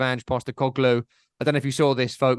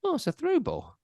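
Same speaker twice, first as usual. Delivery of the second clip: high, soft acted reaction; small, high-pitched voice of someone finding out; said high and quietly to oneself; mock underwhelmed reaction; 13 semitones higher, 4 dB quieter than usual. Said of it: "remember seeing a through ball and going".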